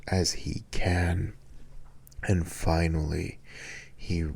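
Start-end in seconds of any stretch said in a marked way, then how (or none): none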